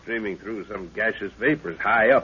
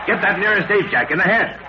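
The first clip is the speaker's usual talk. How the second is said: higher, pressing command to shoot; urgent order; 8 semitones higher than usual. Acted. Leader urgently orders Jack to shoot the ape. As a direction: snap out the order in the heat of the fight.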